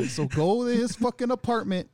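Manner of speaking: high, loud pushy boss voice; bossy impression. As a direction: order him about like a boss. Boss ordering him around.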